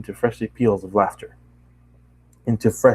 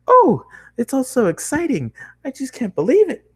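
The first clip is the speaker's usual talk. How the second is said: high, excited nonstop chatter; bubbly pony girl; 7 semitones higher than usual. Acted. Bubbly girl chatters at the new traveler, high and excited. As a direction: gush at the newcomer in a bright rush.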